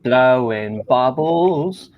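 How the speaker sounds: very slow, drawn-out London vowels; Southern London accent. Shown as a demonstration. Friend giving London accent a shot, slow attempt at London. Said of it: "try a Southern London accent".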